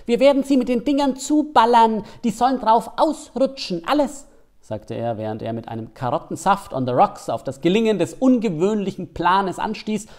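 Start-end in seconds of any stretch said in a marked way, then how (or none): 0.1-4.3 high, loud cartoon hare. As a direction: squeak it out like an excited rabbit. Der Hase prahlt mit Plan. (hohe, laute Hasenstimme)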